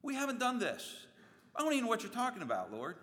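high, fast, indignant denial; protesting denial. Acted. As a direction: plead innocence in a high rush. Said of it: "And they're like".

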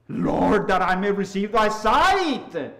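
loud, slow, drawn-out cry to the Lord; loud plea. Retold or imitated. Blind man answers Jesus's question, loud plea. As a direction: plead aloud, stretching the final word.

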